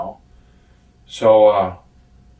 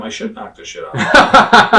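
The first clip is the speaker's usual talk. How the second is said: laughing through a joking threat, louder and higher; 9 semitones higher, 9 dB louder than usual. Real, not acted. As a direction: break into laughter as you joke.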